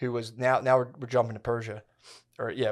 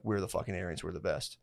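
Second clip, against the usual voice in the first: lower, softer, smug mock boast; mock Aryan bragging; 6 semitones lower, 11 dB quieter than usual. Mocking Iranians who still brag they are the Aryans, low and soft. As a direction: mumble the brag like a proud bro being mocked.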